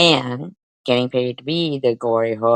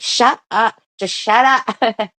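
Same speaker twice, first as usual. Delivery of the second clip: loud, high shut up, the second slower; mock yell; 9 semitones higher, 4 dB louder, slower than usual. Mocking the crowd who yell at him to shut up. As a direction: bark shut up at him, half joking. Voicing people telling him off.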